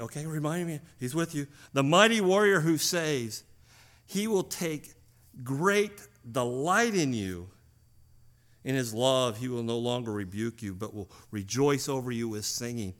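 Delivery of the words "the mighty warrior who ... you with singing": loud, proclaiming scripture; preacher proclaiming Zephaniah's promise, loud; preaching at full force. Real, not acted. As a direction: ring it out to the congregation.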